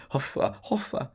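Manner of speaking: high huffing snort of laughter; a barmaid snorting with mocking laughter. A narrator's reading. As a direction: snort it through the nose. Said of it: "huffed and snorted".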